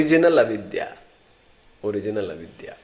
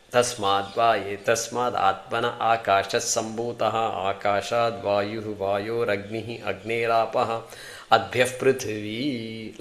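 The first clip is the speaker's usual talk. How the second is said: scripture recitation; 3 semitones lower, 4 dB quieter, much faster than usual. Shown as a demonstration. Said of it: "recites scripture".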